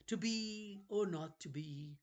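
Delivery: put-on high, eerie old man's voice; a wheedling ancient keeper of books; creepy old librarian. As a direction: play a strange, eager old librarian. Librarian character voice.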